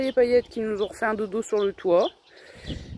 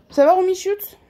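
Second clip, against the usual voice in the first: high pet voice; 9 semitones higher, 6 dB louder than usual. Real, not acted. Calling to her animal.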